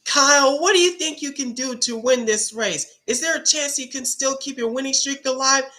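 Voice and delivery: high, squeaky mock interviewer voice. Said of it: "when he pretends to be an interviewer".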